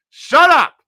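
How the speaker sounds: high, very loud command; teacher's yell. Retold or imitated. Teachers silencing the cursing friend, yelled.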